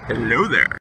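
low silly character voice; deep goofy greeting; deep puppet voice. Acted.

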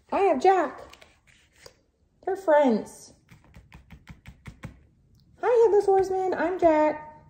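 high playful toy character; light, high pretend voice for a toy. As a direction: make the toy talk to the baby. Voicing toys for the baby.

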